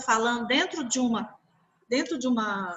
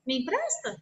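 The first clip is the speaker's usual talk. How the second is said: gentle tone; 5 semitones higher than usual. Shown as a demonstration. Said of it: "e mudar o tom".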